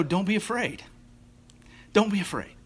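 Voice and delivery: soft, gentle reassurance; calm their fears softly; reassuring. Real, not acted. Softened reassurance to congregation.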